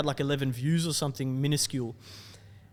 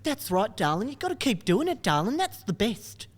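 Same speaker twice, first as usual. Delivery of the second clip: mum's voice; 7 semitones higher than usual. Retold or imitated. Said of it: "She's like".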